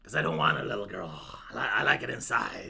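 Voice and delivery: low, fast, odd recluse voice; a spooky shut-in mumbling; mock creepy recluse. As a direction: answer like a weird hermit. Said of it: "And s".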